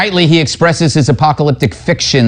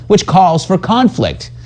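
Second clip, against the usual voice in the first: normal talking voice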